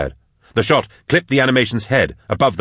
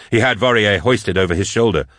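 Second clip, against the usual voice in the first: ordinary delivery, nothing marked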